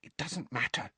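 put-on soft, high hushed girl's voice; whispered. A narrator's reading. Hermione calming her friends about the elf, whispering. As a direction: hush them gently, high and soft. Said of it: "Hermione whispered".

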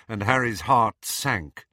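usual speaking voice

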